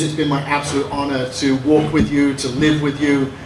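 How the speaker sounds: ordinary delivery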